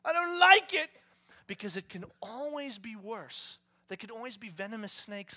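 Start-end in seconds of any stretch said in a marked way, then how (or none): 0.0-0.9 loud, high, slow shouted complaint; mock ungrateful whine. Ungrateful believer to God, loud whiny shout. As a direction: sulk loudly at the plate. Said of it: "look at your provision and go".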